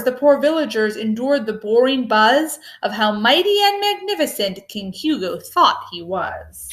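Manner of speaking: ordinary delivery, nothing marked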